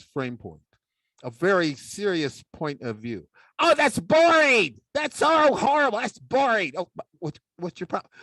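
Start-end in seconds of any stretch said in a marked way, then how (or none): 3.6-6.7 high, loud whiny complaint; bored puppet. Acted. Puppet sidekick heckles Dave's serious talk, high and loud. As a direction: moan about the boring talk, much higher and louder.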